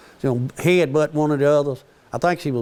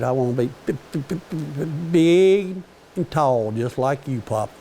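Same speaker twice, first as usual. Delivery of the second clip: put-on stuttering child. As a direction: stretch big and tall like a proud little boy. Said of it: "he kinda stutters a little bit".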